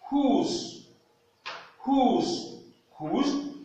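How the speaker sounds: "whose" high and drawn out as "hooz"; model word whose. Shown as a demonstration. Teacher modelling the word for English learners.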